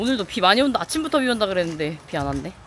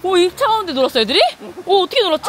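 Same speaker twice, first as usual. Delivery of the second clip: high, louder disbelief; amazed; 9 semitones higher, 3 dB louder, faster than usual. Real, not acted. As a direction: marvel at the cold water. Amazed kids played in icy water.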